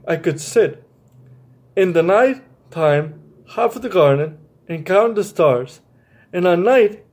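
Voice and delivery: gentle storybook reading voice; reading to children. A narrator's reading. Teacher reading a picture book to young listeners, gentle storytelling.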